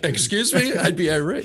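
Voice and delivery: high, put-on voice of the neighbor; mock neighbor. Cohost mocking the neighbor in a high put-on voice. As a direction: play the baffled neighbor in a squeaky voice. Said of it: "And he's like".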